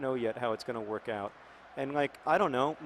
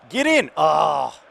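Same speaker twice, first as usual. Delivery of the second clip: shout; 3 semitones higher, 13 dB louder, much slower than usual. Real, not acted. Scoring chance in game.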